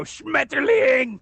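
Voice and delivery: very high, drawn-out bellow; mock German shout. Child startling butterfly watchers with German, slow high bellow. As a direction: bellow it slowly like a comic German outburst.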